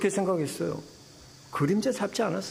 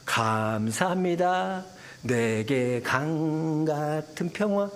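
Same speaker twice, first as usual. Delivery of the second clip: mock serene thanks; 4 semitones lower, much slower than usual. Mock answer Moses did not give.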